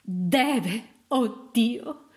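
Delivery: slow, anguished plea; grieving, drawn-out insistence; desperate prayer. A narrator's reading. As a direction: implore God word by word. Mother prays her baby lives.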